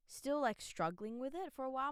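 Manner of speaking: ordinary talk, nothing marked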